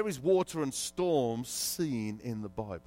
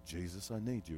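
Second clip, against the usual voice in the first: much softer, low plea to Jesus; desperate quiet prayer; 8 semitones lower, 11 dB quieter than usual. Retold or imitated. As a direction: pray it under your breath. Prayer in crisis.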